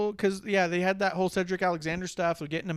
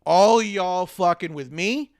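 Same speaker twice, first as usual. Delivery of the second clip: loud, slow mock strongman threat; imitating Braun Strowman; 6 dB louder, much slower than usual. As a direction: drag it out, loud and menacing, like a big brute. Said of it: "Braun Strowman coming out saying".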